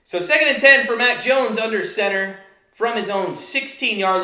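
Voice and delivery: live football call, steady pace; play-by-play. Acted.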